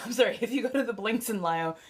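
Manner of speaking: ordinary delivery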